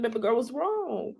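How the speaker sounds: high, soft, tender coo; soothing self-talk. Retold or imitated. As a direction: ask yourself gently what's wrong. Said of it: "It's me talking to myself".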